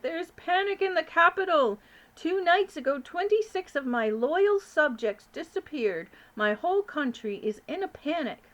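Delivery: loud, distressed foreign king; panicking king. Acted. King of Sweden on the phone, loud and panicked. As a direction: cry out in despair.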